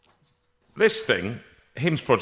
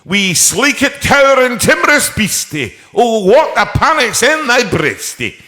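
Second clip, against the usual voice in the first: high, loud Scottish woman reciting verse; woman beside him declaims Burns, high loud Scottish accent; 12 semitones higher, 8 dB louder than usual. Retold or imitated. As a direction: recite the mouse poem in a broad Scots lilt, loud and high. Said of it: "try your wee accent out".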